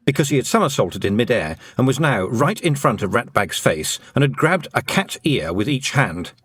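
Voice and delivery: ordinary delivery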